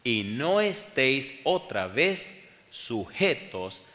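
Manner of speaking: slow, loud weighted reading; emphatic scripture reading. Real, not acted. Preacher reading Galatians to the church, slow and emphatic. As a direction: stretch every word of the verse.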